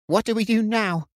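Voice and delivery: high, worried question held in check; anxious, trying to stay steady. A narrator's reading. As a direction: fight to keep the fear down. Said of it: "trying to hold his own voice steady".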